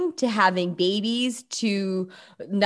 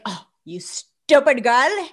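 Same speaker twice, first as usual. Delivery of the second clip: higher, louder, slow theatrical scolding; melodramatic Bollywood scolding; 6 semitones higher, 4 dB louder, slower than usual. Retold or imitated. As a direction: play a soap-opera mother-in-law scolding the girl, slowly. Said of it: "it's so Bollywood where it's like".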